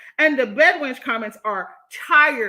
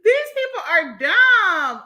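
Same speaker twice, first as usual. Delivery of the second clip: exasperated; 6 semitones higher, 6 dB louder, slower than usual. Real, not acted. Exasperated at commenters.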